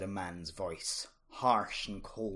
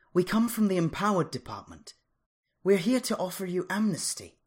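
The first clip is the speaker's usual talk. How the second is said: authoritative; 9 semitones higher, 6 dB louder than usual. A narrator's reading. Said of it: "with an unmistakable note of authority".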